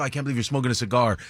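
ordinary delivery

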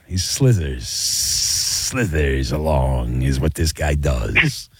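low, very slow, drawn-out snaky delivery; slithering snake impression. Mocking the caller as a snake.

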